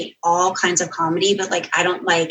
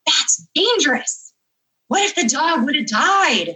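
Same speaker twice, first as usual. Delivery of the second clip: slow, higher disapproving voice; a prim editor scolding the author; stern editor; 8 semitones higher, slower than usual. Retold or imitated. Content editor objects to the dog story, slow and disapproving.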